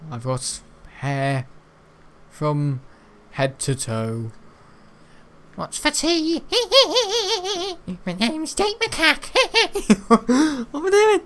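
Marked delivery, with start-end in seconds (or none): 5.6-11.2 high, giggly cartoon monkey voice; silly Jake Macaque voice. Making fun of old classmate, high giggly monkey voice. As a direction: squeal it out, laughing through it. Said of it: "it's my funny little song".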